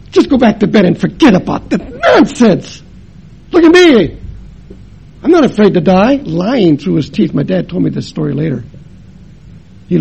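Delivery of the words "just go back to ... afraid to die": dismissive bluster. Retold or imitated. Dad dismissing son's fear.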